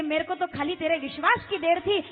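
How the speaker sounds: loud, driving divine proclamation; emphatic proclamation. Acted. Preacher voicing God bursting from the pillar, emphatic proclamation. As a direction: swell to a loud peak, then ease off. God's reply to Hiranyakashyap, acted.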